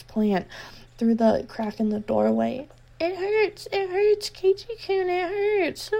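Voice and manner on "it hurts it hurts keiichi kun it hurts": put-on much higher pained girl voice; high pained girl whimper; held-back yelp. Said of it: "a yelp she was trying to keep back".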